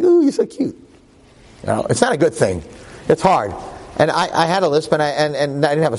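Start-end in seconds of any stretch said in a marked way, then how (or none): 0.0-0.8 mock cooing girls. Girls teasing a boy with a lisp, high mock coo. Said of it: "they're like".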